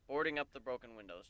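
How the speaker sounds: ordinary delivery, nothing marked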